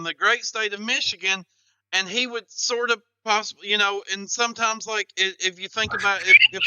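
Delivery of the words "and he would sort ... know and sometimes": ordinary delivery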